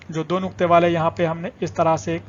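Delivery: usual speaking voice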